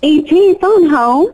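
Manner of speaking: high, demure Southern drawl; Southern belle accent. Shown as a demonstration. Actress playing a demure alien, Southern accent. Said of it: "let's do a Southern belle".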